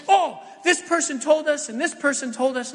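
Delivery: a hint of excited kids chattering; kids' eager voice. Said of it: "They were like".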